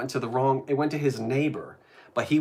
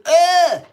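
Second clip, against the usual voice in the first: loud, high-pitched mock gag of disgust; imitated disgusted "ugh"; 12 dB louder than usual. Retelling a friend's reaction to shortcake, loud mock disgust. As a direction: squawk a revolted noise, hammed up. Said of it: "he said".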